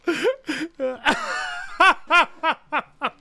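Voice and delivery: high, helpless laughing fit. Real, not acted. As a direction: crack up at your own silly joke. Cracks up at own German accent.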